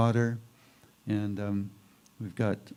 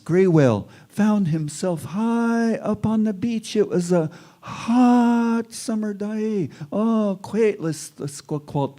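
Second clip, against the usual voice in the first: higher, louder, slow storyteller's chant; oral storytelling; 9 semitones higher, 7 dB louder than usual. Acted. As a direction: draw the listeners into an old legend. Storyteller begins the legend.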